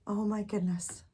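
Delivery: usual speaking voice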